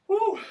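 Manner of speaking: a high, loud whoop of relief; high cheer. Real, not acted. Coach cheering after the jump set, high loud whoop.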